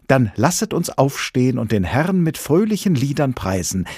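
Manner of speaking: high, rousing call to praise; rally a congregation to rejoice; fervent evangelist's exhortation; exultant preaching. A narrator's reading. Examinee calls all to praise.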